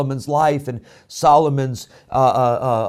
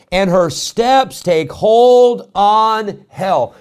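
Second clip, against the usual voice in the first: very slow, loud, high proclamation; forceful scripture reading; 6 semitones higher, 7 dB louder, much slower than usual. Acted. Preacher thundering the verse at his church, very slow and loud. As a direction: drag each word out like a warning.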